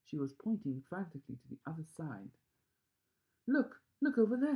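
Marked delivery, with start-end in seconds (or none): 3.5-4.6 high, loud shriek of discovery; excited scream. A narrator's reading. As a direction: shriek and point. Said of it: "she screamed".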